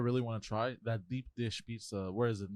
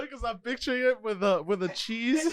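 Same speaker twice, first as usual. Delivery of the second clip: laughing; 10 semitones higher, 4 dB louder than usual. Real, not acted. Laughing over food.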